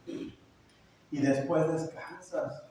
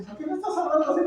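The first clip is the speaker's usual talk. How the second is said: higher-pitched retold question, mildly baffled; puzzled father; 10 semitones higher, much faster than usual. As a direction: lightly act out a puzzled dad. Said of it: "el papá dice". (papá extrañado, imitado en tono más agudo)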